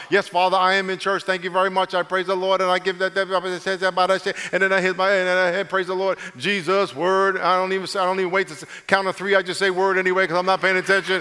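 droning, monotone churchgoer on autopilot; robot. Acted. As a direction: rattle off praise mechanically, all on one note. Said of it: "like, like a robot".

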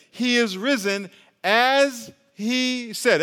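he is risen, said slow and high; slow proclamation. Acted. Preacher proclaiming the risen Christ to the church, slow and lifted. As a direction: draw out every word, preaching.

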